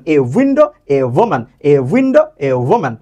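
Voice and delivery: "a window, a woman" with a clear a before the w sound; article demo. Shown as a demonstration. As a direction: model a before window and woman, rising then falling. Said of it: "আপনি কিন্তু বলেন".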